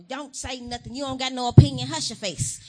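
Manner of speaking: put-on loud, bossy scolding of a child; a parent snapping at a kid; mock scolding parent. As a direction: bark it like a fed-up parent. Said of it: "mad at parents ... yelling at kids".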